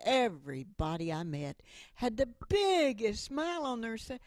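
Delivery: ordinary talk, nothing marked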